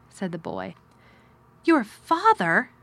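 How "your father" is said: high, loud, drawn-out disbelief; slow, astonished question pitched well up; gasped astonishment. A narrator's reading. Girl learning of her uncle's son, gasping. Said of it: "gasped Mary".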